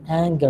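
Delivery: "Anger" with a hard g, "ANG-ger"; the right way, "Anger" as "ang-ger"; correct pronunciation. Shown as a demonstration. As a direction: restate "Anger" firmly with g as in "finger". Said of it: "لازم تلفظوه anger".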